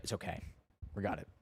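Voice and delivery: calm it down like an unflappable auntie; girlfriend's mom calming the panicked driver, lightly voiced stoic. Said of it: "a very stoic native Chinese woman".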